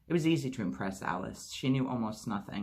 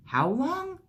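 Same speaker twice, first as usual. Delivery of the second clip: sharp, much higher, louder demand; urgent high question; 6 dB louder than usual. A narrator's reading. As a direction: fire it out, desperate to know. Anxious demand about lost time.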